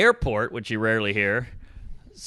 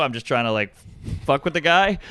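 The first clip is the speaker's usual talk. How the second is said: ordinary talk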